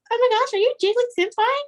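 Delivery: high, excited fangirl squeal; imitated starstruck fan. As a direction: gush in a very high mock-fan voice. Acts out excited fan recognizing Jig.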